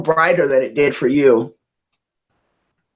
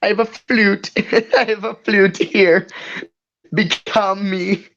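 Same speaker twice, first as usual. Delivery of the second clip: mock plea; 4 semitones higher than usual. Echoes flute bit in put-on voice.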